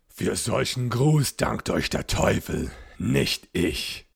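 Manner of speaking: a rumbling, menacing knight's retort; growling ghost knight. A narrator's reading. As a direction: growl it back like a giant of the night. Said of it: "grollte der riesige Nachtgesell".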